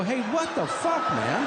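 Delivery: high, shocked squeal of disgust; shocked outrage. Retold or imitated. Son hearing his father's confession, shocked and high. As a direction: recoil in disbelief.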